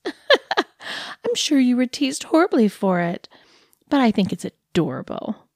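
a lightly voiced cooing dog owner; condescending dog lady. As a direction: talk down to him like a pet, all sweetness.

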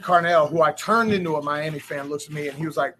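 normal talking voice

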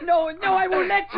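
high, loud desperate protest; boy crying out against the attacker; frantic cry. Acted. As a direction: shout to stop him grabbing the gun. Boy fights off the spy.

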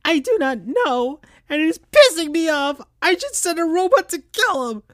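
put-on high, fast, irritated young fox voice; high squeaky anger, rushing; mad and sad. Tails tells Amy he is fed up, high and fast. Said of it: "sounding mad and sad".

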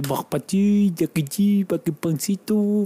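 playful fake French babble; mock French gibberish. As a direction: imitate how French sounds, half-joking. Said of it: "Es lindo el".

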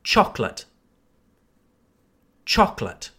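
"Chocolate" in two syllables, stressed CHOK-lət; repeat-after-me model. Shown as a demonstration. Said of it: "repeat after me".

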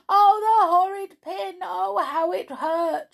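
high, loud wailing in pain; crying in pain. Acted. Amelia Jane crying after the pin pricks her knee. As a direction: cry out over the hurt knee. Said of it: "she cried".

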